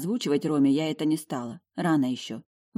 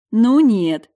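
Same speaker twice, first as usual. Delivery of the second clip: loud, high burst of mock refusal; laughing protest; 6 semitones higher, 10 dB louder than usual. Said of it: "хохотнула я".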